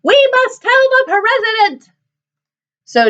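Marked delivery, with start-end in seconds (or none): 0.0-1.9 very high panicked hen squawk; Chicken Licken. Acted. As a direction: cluck it as an urgent alarm. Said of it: "clucked Chicken Licken".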